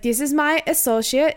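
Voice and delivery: sing-song mock Swedish accent; bad Swedish accent. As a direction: imitate a hammed-up Swedish accent. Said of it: "the bad Swedish accent".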